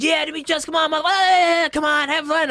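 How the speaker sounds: a coach's high, mock-cheery coaxing; cheerful coach. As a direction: cheer him up, high and upbeat. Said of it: "Fierce's like".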